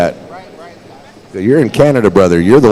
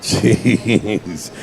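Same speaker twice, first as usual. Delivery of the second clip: normal talking voice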